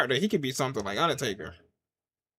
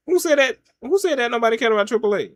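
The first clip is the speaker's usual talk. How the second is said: high, raised-voice challenge; indignant demand; 8 semitones higher, 5 dB louder than usual. Real, not acted. Streamer demands who said nobody cared about Triple A, indignant. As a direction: call out the commenter, pitched up.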